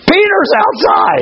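very high, slow excited shout; overjoyed announcement. Acted. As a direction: squeal the news out to everybody. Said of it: "Just ran in and told everybody".

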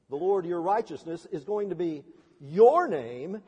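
ordinary delivery

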